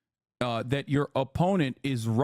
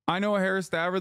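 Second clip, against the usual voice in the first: normal talking voice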